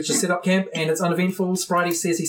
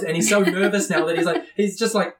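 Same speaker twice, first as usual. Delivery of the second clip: normal talking voice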